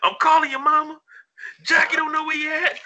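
mock crying movie quote. Quoting a film scene.

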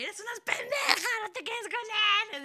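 yelling at Amy on screen, teary and high; tearful plea. Retold or imitated. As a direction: beg the character through mock tears. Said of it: "estaba llore y llore y llore".

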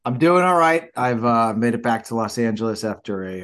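normal talking voice

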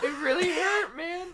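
squeaky mock whine; high mock child. Friend mocking the nephew, high whiny voice. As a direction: mimic the kid's pained line high.

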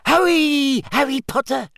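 high squeaky elf voice; squeaky house-elf. A narrator's reading. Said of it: "squeaked Dobby".

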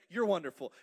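loud, warm mock praise; playful praise. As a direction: sing it out as a loving compliment. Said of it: "the Son would say".

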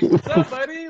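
high, teasing buddy greeting; mock-friendly greeting. Catching Adam mid-dance, teasing high greeting. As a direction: greet him like nothing's weird. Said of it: "And I'm like".

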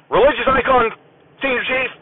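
high, stiff "sir" answer at attention; deadpan like a recruit under inspection; stiff military reply. Retold or imitated. Sailor answering the senior chief at inspection, stiff and deadpan.

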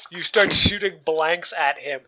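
ordinary talk

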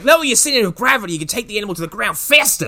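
high, quick Australian coaching; Steve Irwin. Acted. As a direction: rattle off instructions like an Australian wrangler. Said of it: "He's like".

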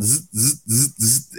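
buzzing zzz in short bursts; repeated short buzzes, mimicking a phone; phone buzz imitation. Imitates phone buzzing on his leg.

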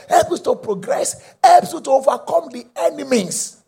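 high, loud, rousing declaration; shouted, fervent proclamation to the congregation; full-force preaching. Real, not acted. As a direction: hammer out each benefit with stretched emphasis.

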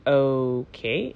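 a low, soft, deadpan okay; flat okay. Retold or imitated. To the boyfriend, deadpan and unimpressed. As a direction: let it drop, unimpressed. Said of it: "That's literally how I said it".

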